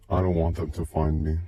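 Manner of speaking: plain reading voice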